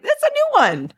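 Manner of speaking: very high-pitched retold reaction; amused mock surprise. Telling co-host about chickens interrupting, high mock-surprised voice. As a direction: squeak it out in playful disbelief.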